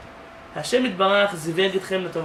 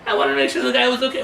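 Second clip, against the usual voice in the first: higher, louder disbelieving husband; incredulous husband; 6 semitones higher, 4 dB louder than usual. Retold or imitated. Husband to his angry wife, higher and louder in disbelief. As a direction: push back in disbelief, quicker.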